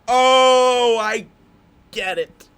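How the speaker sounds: huge high drawn-out "oh", then settling; mock realisation. Viewer reacting to the title drop, mock-amazed shout. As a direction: shout the penny dropping, hammed up.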